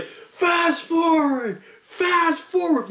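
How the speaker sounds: high, drawn-out mock yell without much volume; imitated yelling. Audience yelling at the projectionist, a held-back yell. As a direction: stretch each word like calling across a theater. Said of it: "we're literally yelling in the theater".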